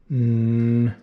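ordinary talk

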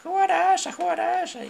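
high mocking voice parroting the followers; high mock parrot of fans' advice; mocking Twitter fans. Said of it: "estas cosas que me recomiendan por Twitter".

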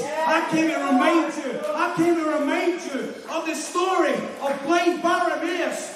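high, driving revival preaching. Real, not acted. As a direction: preach it like you feel it. Preaches at full force.

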